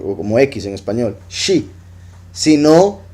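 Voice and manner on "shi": shi with a soft sh; sh sound. Shown as a demonstration. Teacher demonstrates the shin sound as sh. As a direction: say shi with sh, not s. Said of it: "la shin no la pronunciaban como S H".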